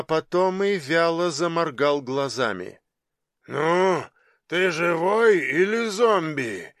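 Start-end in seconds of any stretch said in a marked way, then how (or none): 3.5-6.7 slow, drawn-out question in a giant's voice; cheerful giant. A narrator's reading. Giant checks on the revived youth, cheerful. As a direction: play a jovial giant, taking his time. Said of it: "весело спросил гигант".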